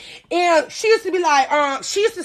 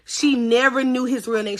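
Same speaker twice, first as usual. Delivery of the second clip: ordinary talk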